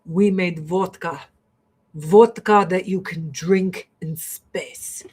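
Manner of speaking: Russian guide boasting about vodka, in a put-on Russian accent. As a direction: play the proud Russian guide in a thick Russian accent. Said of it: "they're like".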